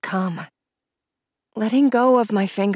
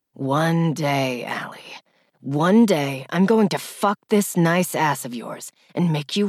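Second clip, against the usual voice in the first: lower, intimate man's voice; lower, lingering promise; low male lover voice; 5 semitones lower than usual. A narrator's reading.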